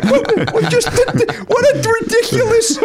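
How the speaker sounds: very high, slow, breaking into laughter; laughing. Real, not acted. Reliving the ridiculous fight offer, laughing hard. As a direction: laugh till the voice cracks.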